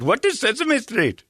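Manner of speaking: high, slow mock Indian accent; imitating a boy's Indian accent. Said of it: "in Indian accent".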